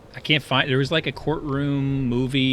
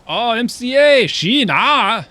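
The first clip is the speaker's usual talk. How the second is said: imitating Mattson, much higher and louder, in a thick Swedish accent; 12 dB louder, slower than usual. Streamer greeting his chat with a thick Swedish-accented hello. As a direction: sing out "Sheena" with a strong Swedish lilt, much higher and louder. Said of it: "he's like".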